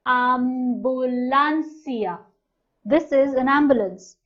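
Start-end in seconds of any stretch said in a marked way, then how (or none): 0.1-2.2 ambulancia drawn out syllable by syllable; teacher modelling a new word; slow and clear. Shown as a demonstration. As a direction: spell it out slowly with your voice. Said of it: "Now we have".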